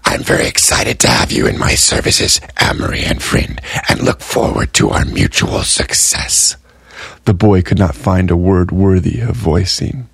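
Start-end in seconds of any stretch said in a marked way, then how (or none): 0.1-6.6 hushed, whispered tally keeper laying out terms; a whispering dealer spelling out a binding bargain; Gray's whispered voice. Acted. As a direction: read the contract in a secretive whisper. Gray welcomes his new collectors.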